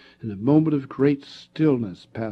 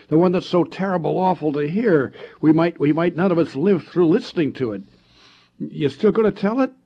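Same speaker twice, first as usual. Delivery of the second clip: put-on higher and louder, a boy goading a friend; taunting dare; 7 semitones higher, 7 dB louder than usual. Bill daring Eddie round the campfire, higher and louder. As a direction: egg him on to tell the scary story.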